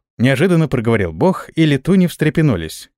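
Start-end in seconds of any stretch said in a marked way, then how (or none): none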